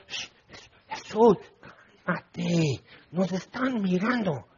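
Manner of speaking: soft, furtive mock shushing; hushed warning. Disciples trying to calm Jesus as people watch, hushed warning. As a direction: hush him under your breath.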